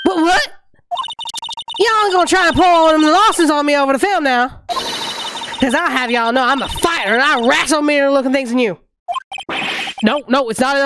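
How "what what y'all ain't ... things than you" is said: lightly voiced Southern lady, offended; Southern accent. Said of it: "give her the southern accent".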